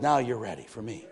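hushed, low assurance from God; God's quiet verdict. Retold or imitated. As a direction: drop low and soft for the pronouncement. God's verdict, acted by preacher.

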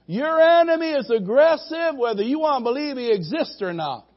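high, loud preacher's roar building on the devil; fiery pulpit warning, high and loud; preaching at full force. Acted. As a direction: thunder the warning about the enemy.

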